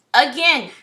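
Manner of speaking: a loud, fed-up cry of disbelief; exasperated again. Real, not acted. Reader to viewers, annoyed at the repeated card. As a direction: throw it out like the deck is trolling you.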